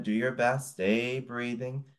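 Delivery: ordinary delivery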